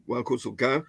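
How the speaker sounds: kosoka accented on the final "ka"; stress on last syllable. Shown as a demonstration. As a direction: end "kosoka" on a rising, stressed "ka". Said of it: "accent should have been on the last syllable then".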